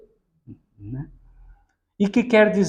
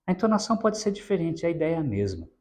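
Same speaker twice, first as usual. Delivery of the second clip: ordinary talk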